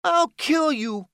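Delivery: high, loud, slow raging scream; screaming threat. A narrator's reading. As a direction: scream the threat with all he has. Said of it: "Haven screamed".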